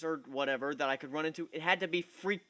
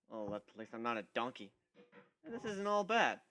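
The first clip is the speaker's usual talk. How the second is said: usual speaking voice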